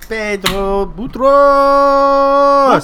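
long, loud, high drawn-out name; hammed-up drawn name. Host hams up guest's nickname with a long loud call. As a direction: stretch the name like a ring announcer.

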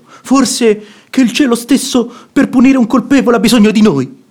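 rising indignation at a hypocrite's excuses; indignant rebuke. Acted. Brother-in-law rebukes the hypocrite.

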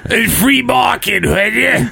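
high-pitched mock politician shout-out; exaggerated politician impression. Shown as a demonstration. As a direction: push the impression up high and cartoonish. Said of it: "If you just went".

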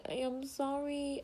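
pleading high sorry; stretched pleading apology; high, slow sorry. Real, not acted. Repeated apology higher.

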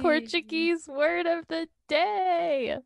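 high, drawn-out jingle-like announcer call; segment announcement. Pretend. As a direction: announce the segment with playful fanfare. Host announces the podcast's favourite segment.

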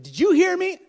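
high, shouted mock-wife demand; exasperated wife. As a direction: blast the question at a husband who ignored you. Exasperated wife confronting husband, acted.

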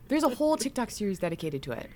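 usual speaking voice

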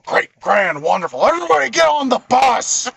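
loud, rising furious shout; angry bus driver. Retold or imitated. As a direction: bark it like a fed-up driver. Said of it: "he's a very angry bus driver".

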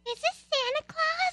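very high little-girl voice, hammed-up; most girly voice. Child calls the colonel by mistake, put-on girly voice. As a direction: squeak it like a small girl on the phone. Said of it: "in your most girly voice".

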